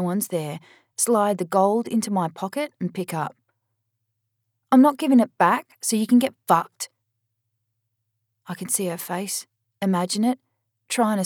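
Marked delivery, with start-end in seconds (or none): 4.7-6.9 loud, higher, spitting it at her; defiant yell. Acted. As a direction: tell her off at full volume. Charlie snaps at sister on phone.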